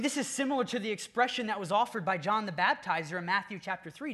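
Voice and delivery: ordinary delivery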